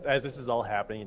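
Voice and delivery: ordinary talk, nothing marked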